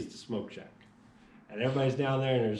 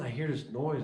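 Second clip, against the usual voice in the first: normal talking voice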